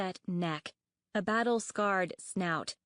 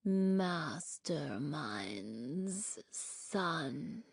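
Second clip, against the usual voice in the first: very slow, much softer creaky rasp; eerie rasp; 3 semitones lower, 5 dB quieter, much slower than usual. A narrator's reading. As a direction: scrape each syllable out slowly. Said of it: "she rasped".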